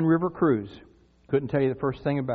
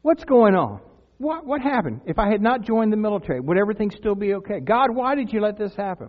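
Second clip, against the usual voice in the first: high, pleading retold prayer; crying out to God; 5 semitones higher than usual. Jilted soldier questions God after breakup, high pleading. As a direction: replay a bewildered plea, pitched up. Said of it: "Cried out to God".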